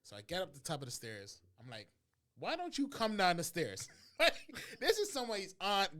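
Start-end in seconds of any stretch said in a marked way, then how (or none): 2.4-3.9 loud, slow, fed-up demand; loud slow exasperated quote; exasperated yell. Retold or imitated. Annoyed quote to old driver. 3.9-6.0 laughing loudly while talking; words bursting through laughter; high loud laughing talk. Real, not acted. Laughing at own story.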